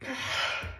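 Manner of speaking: shaky exhausted sigh; tearful sigh. Real, not acted. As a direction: let out a weepy breath. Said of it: "I can't stop crying".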